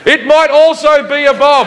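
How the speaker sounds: high-pitched, hammed-up comeback; mock defensive retort. Traveller answers security guy about laptop, high mock defensive retort. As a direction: push it out high and insistent. Traveller's reply to sarcastic official.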